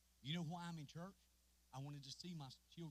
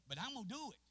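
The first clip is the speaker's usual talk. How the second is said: raised, higher preaching declaration; preaching vow; 7 semitones higher, 7 dB louder than usual. Acted. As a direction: declare it with force.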